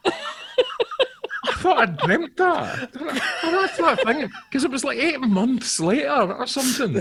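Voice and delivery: real laughter through very high, slow words; laughing. Real, not acted. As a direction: laugh helplessly as you tell it. Laughing at his own absurd story.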